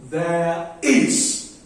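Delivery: unstressed "There", then a punched "is"; two-beat demo with "is" stressed. Shown as a demonstration. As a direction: hit "is" after an even "There". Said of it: "unstressed is stressed".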